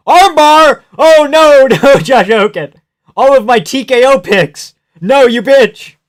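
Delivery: loud, high-pitched outburst of exasperation; dismayed yelling. Real, not acted. Viewer cursing the fighter's armbar attempt, dismayed yelling. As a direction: yell in real dismay at the fight.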